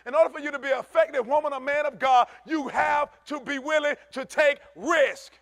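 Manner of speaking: loud, high preaching at full force. Acted. Preaching about taking risks.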